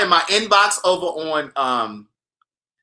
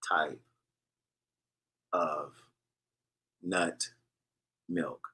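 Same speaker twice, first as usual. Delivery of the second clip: one word at a time, much softer and lower; teasing slow-motion recipe read, whispery and low; exaggerated slow dictation; 15 dB quieter, much slower than usual. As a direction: drag each word out with long pauses. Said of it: "I'm gonna say it really slowly".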